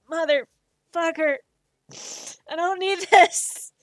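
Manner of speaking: loud, laughing mock protest; hammed-up overwhelmed yell; mock outburst. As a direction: laugh through a fake complaint. Overwhelmed fan reacting to speech.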